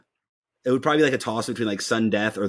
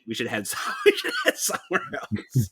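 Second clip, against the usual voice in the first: laughing; 3 dB quieter than usual. Retold or imitated. Laughing while quoting himself.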